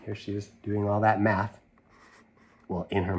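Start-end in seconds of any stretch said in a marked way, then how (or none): none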